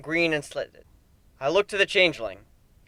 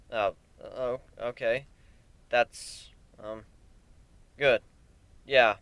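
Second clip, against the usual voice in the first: shaky, hesitant and unsure; nervous stammer; 4 semitones lower, 6 dB quieter, slower than usual. A narrator's reading. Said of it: "with a slight twitch in my claws".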